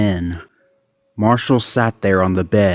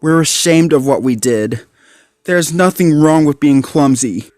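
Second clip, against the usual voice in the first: ashamed; 6 semitones higher than usual. A narrator's reading. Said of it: "lowering his head".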